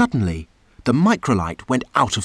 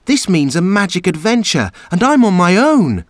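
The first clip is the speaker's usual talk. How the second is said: gasped; 7 semitones higher than usual. A narrator's reading. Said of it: "gasped Chip".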